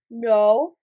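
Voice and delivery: low, loud boss voice; Big Boss figure. Acted. Big Boss rejects Mikael's guess, low and firm. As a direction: turn the guess down firmly.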